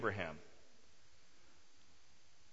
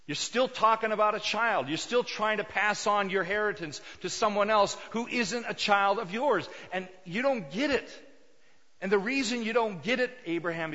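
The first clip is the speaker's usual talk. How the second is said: louder, higher, insistent reproof; exasperated scolding; 5 semitones higher, 5 dB louder than usual. Acted. God rebuking Abraham for clinging to his plan, exasperated scolding. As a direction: drive the point home, frustrated.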